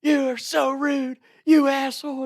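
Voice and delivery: high, loud mock screech; imitated screaming. Dock girls scold the tackler, imitated high scream. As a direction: screech it like an outraged girl, very high-pitched. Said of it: "hear the other dock girls screaming".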